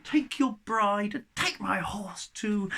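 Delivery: high, loud and delighted royal permission; the pleased tsar grants his gifts gladly. Acted. Tsar Afron rewards Ivan, high and delighted. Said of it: "he's so delighted".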